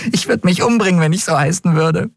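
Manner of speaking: put-on high street-kid girl voice; lively young girl, matter-of-fact. A narrator's reading.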